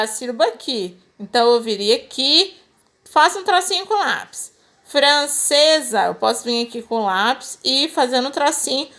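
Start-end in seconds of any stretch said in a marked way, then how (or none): 4.8-6.1 "Francesa" drawn out slowly by syllable; slow, teacherly sounding-out of "Francesa". Shown as a demonstration. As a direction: stretch "Francesa" to show where the syllables divide. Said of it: "aonde que separa a sílaba".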